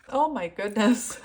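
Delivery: loud exclamation breaking into a high laugh; teary, overwhelmed giggle; laughing and crying. Real, not acted. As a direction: lose it again, helplessly. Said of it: "laughing and crying".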